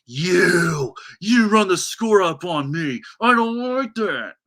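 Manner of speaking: loud, high, angry complaint; Todd Haley complaining. Retold or imitated. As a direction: rant like an angry coach. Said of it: "it's Todd Haley going".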